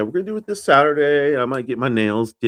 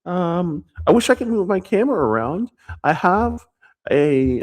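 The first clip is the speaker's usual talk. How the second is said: raised-pitch practice voice; voice held up; 7 semitones higher, 6 dB louder than usual. Shown as a demonstration. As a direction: keep talking in a higher register. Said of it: "keep my voice up like this".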